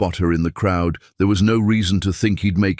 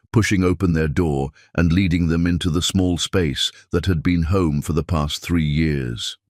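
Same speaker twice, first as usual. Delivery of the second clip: ordinary delivery, nothing marked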